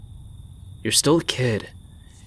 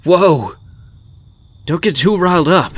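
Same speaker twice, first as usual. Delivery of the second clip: startled; 7 semitones higher, 8 dB louder than usual. Acted. Startled by her shout.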